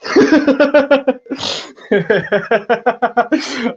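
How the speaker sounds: big high laugh; loud burst of laughter. Real, not acted. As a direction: crack up out loud. Laughing at a sarcastic remark.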